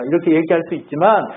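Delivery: high, ringing proclamation from the pulpit; fervent preaching. Real, not acted. Preacher telling believers they are princes and princesses, fervent preaching. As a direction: declare it to everyone with building fervour.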